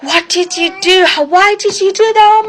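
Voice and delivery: high-pitched, drawn-out mock horror; massive overreaction. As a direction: imitate staff shrieking at a tiny mistake. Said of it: "The reaction that they would give would be, like, massive".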